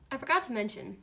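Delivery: talking through a bite of food; words muffled by chewing; mouth full. Real, not acted. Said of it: "Sorry, I had to finish the bite".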